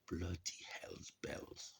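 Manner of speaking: breathy whispered curse; whispered oath. Real, not acted. Cursing quietly at the muddle, whispered.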